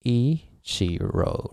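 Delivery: exaggeratedly drawn-out EE... chee-ro; English-style pitch. Shown as a demonstration. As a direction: stretch Ichiro out with a falling English tune. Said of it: "the pitch would be".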